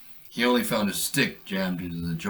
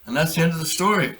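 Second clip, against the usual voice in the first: bright sign-off; 7 semitones higher, 4 dB louder, faster than usual. Real, not acted. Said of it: "closing sign-off".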